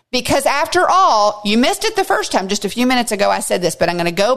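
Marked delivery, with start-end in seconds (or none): 1.4-2.5 higher, faster insistence; emphatic repeat. Real, not acted. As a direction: drive the point home, quicker and higher.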